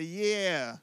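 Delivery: hearty call and response line; call to repeat. Shown as a demonstration. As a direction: lead the congregation in the response.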